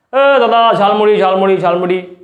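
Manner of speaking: hawker calling out the snack name; street vendor call. Acted. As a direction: call out like a jhalmuri seller.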